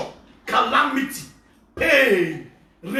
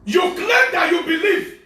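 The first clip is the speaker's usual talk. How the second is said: slow, loud higher accusation; accusing preacher; 7 semitones higher, 4 dB louder, slower than usual. Acted. Pastor accusing false believers, slow loud rebuke. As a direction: hurl it at them slowly.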